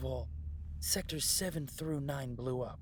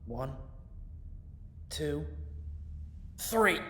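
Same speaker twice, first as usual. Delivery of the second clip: straining shout; much slower than usual. Acted. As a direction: heave with everything on the last number. Pulling a heavy door.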